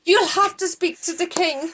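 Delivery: high, loud urgent warning; urgent Mordecai. Acted. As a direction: rush in with dreadful news to the queen.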